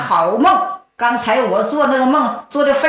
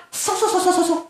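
whooshing sound effect; 6 semitones higher, 5 dB quieter, faster than usual. Acted. Heroine runs off, whooshing.

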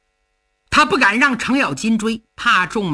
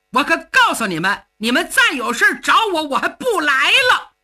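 higher, hurt and furious; indignant outburst; 6 semitones higher than usual. Acted. Cheng raging at his sworn brother for siding against him. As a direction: slam the door on old sworn brothers.

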